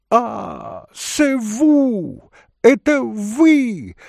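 very high, loud, drawn-out delight; joyful cry. Acted. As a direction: squeal out a delighted greeting. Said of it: "закричала Глафира Петровна с неподдельною радостью". (радостный крик высоким голосом)

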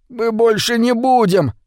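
high, thin pleading voice; whispered plea. A narrator's reading. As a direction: promise in a small high half-whisper. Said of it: "прошептал Митя". (тонким голоском, будто шёпотом)

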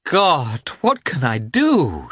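on the edge of tears; a hint of weeping young woman; crying out. A narrator's reading. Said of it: "she cried, and began to weep".

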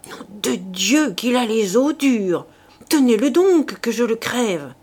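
loud, raging rough soldier yell; furious shout. A narrator's reading. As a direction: roar in frustration at the horse. La poule furieuse frappe le cheval. (crié avec rage, à pleine voix)

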